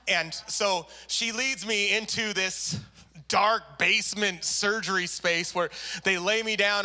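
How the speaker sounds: ordinary talk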